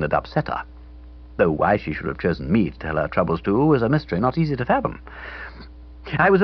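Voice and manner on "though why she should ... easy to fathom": usual speaking voice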